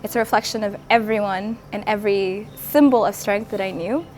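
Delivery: ordinary delivery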